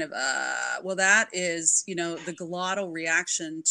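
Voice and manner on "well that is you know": ordinary talk, nothing marked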